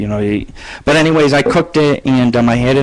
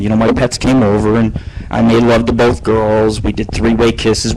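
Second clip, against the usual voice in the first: ordinary delivery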